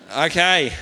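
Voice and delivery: ordinary delivery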